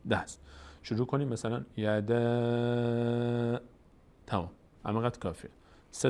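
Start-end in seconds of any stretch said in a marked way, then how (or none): 1.8-3.6 "یده" with a long, level final ae; madd, three-finger length. Shown as a demonstration. Teacher timing madd by closing three fingers, sustained vowel. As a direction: hold the thin last vowel of "یده" steadily.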